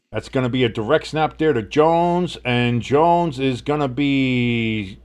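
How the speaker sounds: live sports call, rising on the throws; announcer calling the drive; play-by-play. Acted. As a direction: call the game like a broadcaster.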